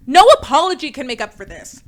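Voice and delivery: loud, dramatic declaration; mock formal apology voice; mock announcement. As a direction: boom it out like a statement. Mock solemn statement.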